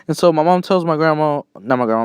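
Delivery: ordinary talk, nothing marked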